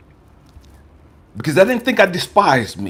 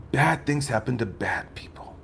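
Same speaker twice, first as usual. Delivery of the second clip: whispered line; 6 semitones lower, 11 dB quieter than usual. Shown as a demonstration. Whispered after louder takes.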